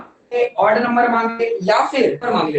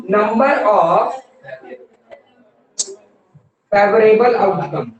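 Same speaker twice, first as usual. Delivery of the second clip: each word drawn out slowly for the class to write; like a teacher reading a formula aloud for notes; slow dictation; 2 semitones lower, much slower than usual. Shown as a demonstration.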